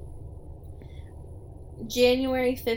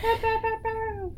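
hype cheer; 12 semitones higher than usual. Pretend.

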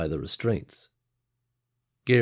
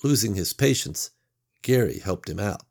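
plain reading voice

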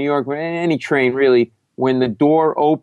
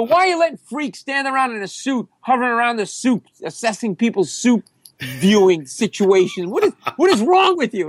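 high, exasperated mock rant; hammed-up high-pitched complaint; yelling; 10 semitones higher than usual. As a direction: berate the worker in outrage. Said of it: "I'm yelling, right? I'm screaming".

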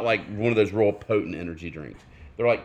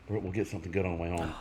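ordinary talk, nothing marked

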